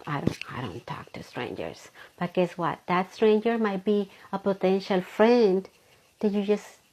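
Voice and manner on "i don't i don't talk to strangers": mock-shy whisper, breath only. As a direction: hide the words in a timid whisper. Shy person refusing strangers.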